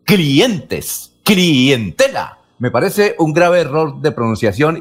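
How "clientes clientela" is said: drawn-out i held apart from e in "clientes, clientela"; diphthong split into hiatus. Retold or imitated. Listener quotes coastal reporters to the professor, splitting the diphthong. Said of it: "Se escucha que dicen".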